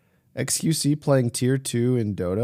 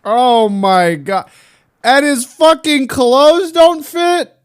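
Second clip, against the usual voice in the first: slow, high, loud disbelief; shocked disbelief; 11 semitones higher, 10 dB louder, slower than usual. Real, not acted. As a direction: stretch it out, stunned and loud. Shocked at the player's clothes.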